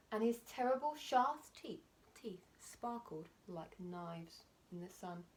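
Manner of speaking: usual speaking voice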